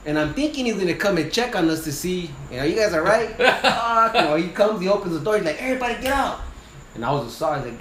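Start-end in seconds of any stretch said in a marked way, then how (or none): 2.6-3.4 higher, laughing as he puts on the captain's concern; a chuckle breaking through a mock-caring question. Teller mocks the captain he expected, laughing. 5.6-6.4 much higher retold bark of an order. Captain orders the crew out of the truck, urgent bark.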